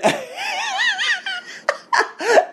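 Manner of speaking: high-pitched burst of laughter; long laugh breaking into the rant. Real, not acted. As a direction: crack up at your own outburst. Laughs at his own cursing.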